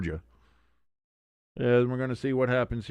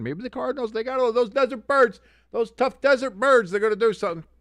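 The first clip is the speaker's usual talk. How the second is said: high-pitched self-mockery; exaggerated hopeful squeal; 12 semitones higher, 3 dB louder than usual. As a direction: parody your own naive optimism, pitched way up. Mimicking own earlier hopeful take.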